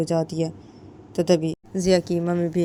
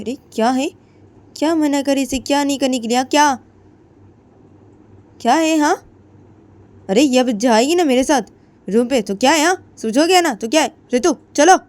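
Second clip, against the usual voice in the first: loud, high angry yelling, much higher and louder; angry shout; 8 semitones higher, 9 dB louder than usual. Acted. Jiya yelling angrily at the family to take Ritu away. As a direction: snap at them all and drag her off. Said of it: "तो तभी जिया बोलती".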